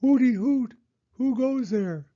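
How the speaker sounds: high, loud owl call. Acted. Owl calling out into the fog, high and loud. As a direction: hoot out into the fog. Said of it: "called Owl".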